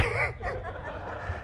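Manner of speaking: a quiet, airy chuckle; soft breathy laugh. Real, not acted. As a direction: laugh softly at your own story. Laughs after the story.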